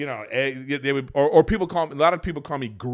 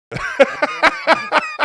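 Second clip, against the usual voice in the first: very high squealing laugh; 6 dB louder than usual. Real, not acted. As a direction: squeal with laughter mid-sentence. Laughs mid quote.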